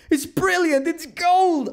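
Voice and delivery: high, loud burst of delight; ecstatic praise. Real, not acted. Fan praising an actor's invented accent, ecstatic. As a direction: squeal the praise, pitch way up.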